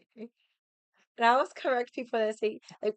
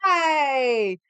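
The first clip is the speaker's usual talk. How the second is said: loud toast; 5 semitones higher, 9 dB louder than usual. Retold or imitated. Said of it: "I hold up the sake glass".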